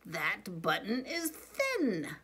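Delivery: slow, clipped-out mock anger in a changed voice; getting mad. A narrator's reading. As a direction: spell out each word as if losing patience. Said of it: "I think he's getting mad".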